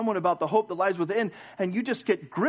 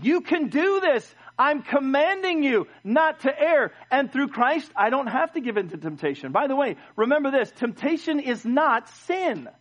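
raised pitch, stretched key words; forceful preaching; 7 semitones higher than usual. Acted. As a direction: press the command on the congregation. Preacher drives the point home.

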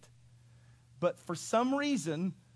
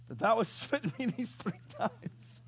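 higher voice breaking up with real laughter; laughing; 4 semitones higher than usual. Real, not acted. Preacher voicing the beaten donkey, cracking up laughing. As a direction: try to finish the donkey's line through laughter. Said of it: "I'm laughing".

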